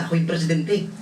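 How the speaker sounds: ordinary talk, nothing marked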